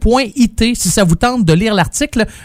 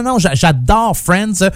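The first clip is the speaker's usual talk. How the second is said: ordinary delivery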